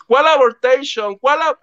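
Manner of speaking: high, slow baffled gringo Spanish; mock shocked Gabriel. Gabriel reacting to the abortion news in high mock disbelief. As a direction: stretch it out, stunned and lost. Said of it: "Así".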